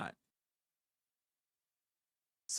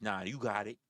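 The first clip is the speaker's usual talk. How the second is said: backing down; 7 semitones lower than usual. Retold or imitated. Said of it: "and they're like".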